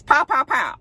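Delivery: three punchy high-pitched pows; imitated gunshots. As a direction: mimic quick shots with your voice.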